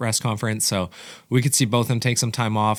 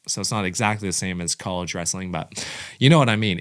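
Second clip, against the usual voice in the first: ordinary talk, nothing marked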